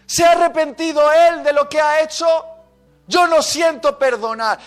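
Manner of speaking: loud, high outraged questions; acted furious refusal; indignant protest. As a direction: protest hotly, climbing in pitch and volume. Acting out protest against forgiving.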